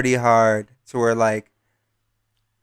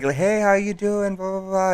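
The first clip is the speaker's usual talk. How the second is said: put-on higher, friendly girl voice; imitating Sarah; 8 semitones higher than usual. Storyteller mimicking Sarah greeting him, pitched up.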